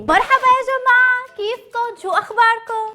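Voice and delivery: hello in put-on Syrian Levantine; تحية باللهجة السورية; Syrian dialect. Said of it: "وانا بتكلم سوري".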